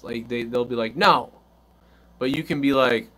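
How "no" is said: put-on sharp, loud child's refusal; a kid flatly refusing, loud and high; kid's loud no. Child refusing parent's request.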